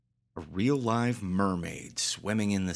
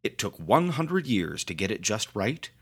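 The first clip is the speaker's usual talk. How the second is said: ordinary delivery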